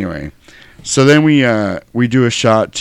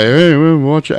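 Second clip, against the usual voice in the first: warning jerk; 6 semitones higher, 4 dB louder, slower than usual. Retold or imitated. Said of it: "he's telling the guy".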